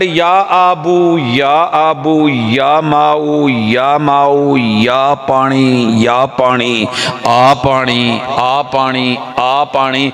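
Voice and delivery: slow, low, drawn-out calls of a lazy man; a hint of a helpless man calling for water; mock pleading for water. Man sitting by the canal calls for water, slow mock plea.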